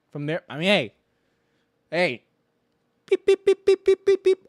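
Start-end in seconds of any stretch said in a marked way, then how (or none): 3.0-4.5 high, loud robotic beeping; mock calculator noise; calculator beeps. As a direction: beep like a calculator adding numbers. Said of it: "Adding up some numbers".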